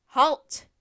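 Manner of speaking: very loud horseman's order; shouted command. A narrator's reading. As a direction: shout to stop them dead. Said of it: "the lead horseman shouted again".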